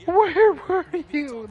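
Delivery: high, slow anguished cry; hammed-up sideline meltdown; coach having a breakdown. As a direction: demand it like a devastated coach. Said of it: "The coach is having a breakdown".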